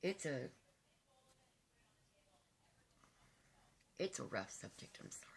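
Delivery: choked up, halting between phrases; real crying. Real, not acted. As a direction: voice sinking away on the apology. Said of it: "Forgive me for crying".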